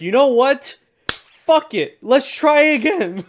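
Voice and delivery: high, loud fired-up outburst; excited shout. Retold or imitated. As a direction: yell it like a reckless dare. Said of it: "Gary's like".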